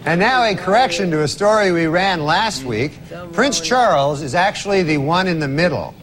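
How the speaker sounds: loud newsreader correction; news anchor. Acted. Mock news correction read.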